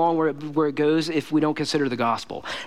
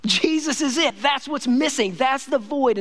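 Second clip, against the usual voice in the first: higher, slow exclamations of discovery; awed realization; 6 semitones higher, slower than usual. Retold or imitated. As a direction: cry out as if finally seeing it. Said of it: "They looked and they saw".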